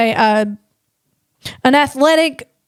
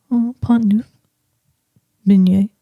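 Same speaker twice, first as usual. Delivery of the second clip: mock French words; 4 semitones lower than usual. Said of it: "They talk the French".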